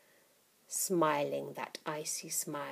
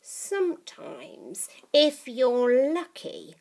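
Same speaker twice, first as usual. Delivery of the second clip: put-on old witch voice; 7 semitones higher, 6 dB louder, slower than usual. Grandma voiced.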